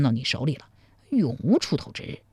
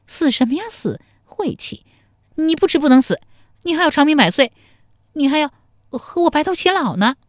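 coy scolding; 10 semitones higher, 4 dB louder than usual. A narrator's reading. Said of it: "嗔道".

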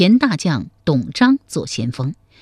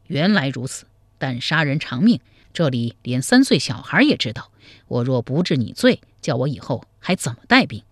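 plain reading voice

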